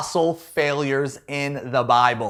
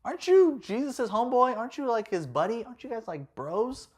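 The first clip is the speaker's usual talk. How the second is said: higher, softer curious dude; a stranger quizzing Peter; nosy bystander; 7 semitones higher, 5 dB quieter than usual. Retold or imitated. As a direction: sidle up and needle him. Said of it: "a dude walks up to him and is like".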